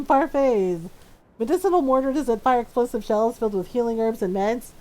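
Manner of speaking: ordinary talk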